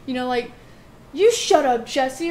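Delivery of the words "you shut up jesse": loud, higher mock comeback from a bratty brother; acted sibling retort, punched loud; imitated brother. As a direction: imitate a brother yelling back. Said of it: "he'd say something like".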